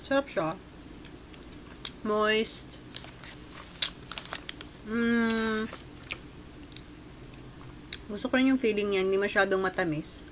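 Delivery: speaking between bites; talking while chewing. Real, not acted. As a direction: mouth full of pastry. Seen 0: Holds bitten pastry, bites at +1.0, chews while talking throughout.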